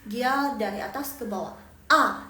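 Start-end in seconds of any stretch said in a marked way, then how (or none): 1.9-2.3 "A" short and loud, falling from the top; firm falling tone. Shown as a demonstration. Teacher shows fourth tone on A, firm fall. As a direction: hit "A" firmly and drop it. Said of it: "jadi dia agak tegas".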